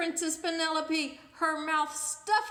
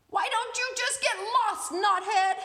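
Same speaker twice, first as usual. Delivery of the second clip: much higher, louder, snotty yell; yelling princess; 7 semitones higher, 10 dB louder than usual. Acted. Bratty princess yelling at the parrot, loud high shout. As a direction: yell at the bird in a huff. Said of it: "and yelled".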